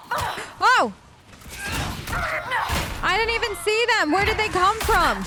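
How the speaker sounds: high-pitched startled protest. Real, not acted. Player ambushed by unseen enemies, high startled outcry. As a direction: cry out, caught completely off guard.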